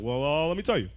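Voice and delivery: comic imitating Obama boasting to reporters; low, slow Obama-style voice. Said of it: "And he'd be like".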